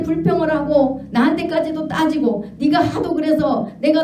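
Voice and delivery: high, sustained rebuke in God's voice; a preacher voicing God's stern reproach; God rebuking. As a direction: lay out every complaint like a disappointed father. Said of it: "하나님이 그러시더라는 거예요".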